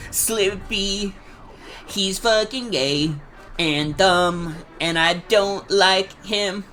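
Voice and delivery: high, slow jokey parody-singer take. Retold or imitated. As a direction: put on a whiny song-parody voice, drawn out slow. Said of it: "my best [name] impression".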